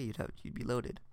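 usual speaking voice

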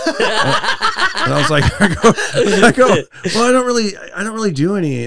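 high, laughing fluster. Retold or imitated. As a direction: laugh it off, caught out. Caught off guard by question.

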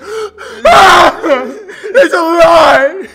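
high, loud, drawn-out wail; mock anguished scream. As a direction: scream it like a betrayed fan. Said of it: "sitting there going".

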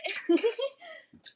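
sudden high laugh burst; bright laugh. Real, not acted. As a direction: laugh it off at yourself. Laughs at her snacking.

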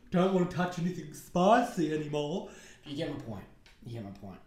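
ordinary delivery

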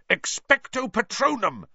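high, forceful incantation; bellowed spell. A narrator's reading. Boy casting as the Dementor rises, bellowed spell. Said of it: "Harry bellowed".

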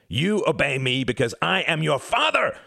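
loud, high fatherly roar; thundering. Acted. Father thundering at his defiant son, loud and high. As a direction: bellow it down at the son. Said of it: "thundered Quentin".

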